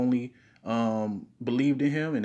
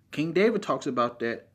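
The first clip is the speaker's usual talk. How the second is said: usual speaking voice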